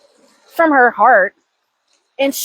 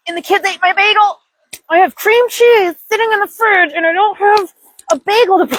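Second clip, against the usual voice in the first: higher, agitated retelling of the bagel loss; upset rant; 7 semitones higher than usual. Retold or imitated. Mom ranting to viewers about eaten bagel, upset. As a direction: vent it like it still stings.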